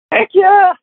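high, loud burst of enthusiasm; eager yes. Retold or imitated. Man answering the trophy offer, excited heck yes. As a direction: leap at it, shouting.

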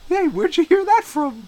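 high, loud comic voice; put-on questioner. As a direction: squeal the question as a mock challenger. Acts out an exchange with himself.

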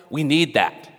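usual speaking voice

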